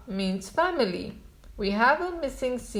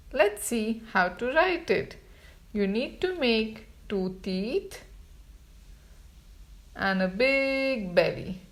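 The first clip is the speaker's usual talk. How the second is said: usual speaking voice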